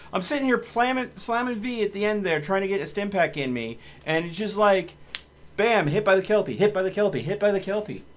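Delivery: loud, high exasperated shouting; angry rant. Real, not acted. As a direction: rant at the game, hammering each word. Raging, recounting the death loudly.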